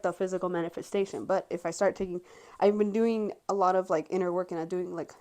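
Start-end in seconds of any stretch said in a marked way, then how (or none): 4.5-5.1 running out of air; breath giving out mid-phrase. Real, not acted. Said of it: "I ran out of breath".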